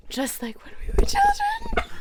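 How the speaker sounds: high, breathy seductive whisper; mock seductive whisper. A narrator's reading. Magenta whispering into Jaden's ear, a hammed-up high seductive voice. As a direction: purr it into his ear, hammed up. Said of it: "she whispered into his ear".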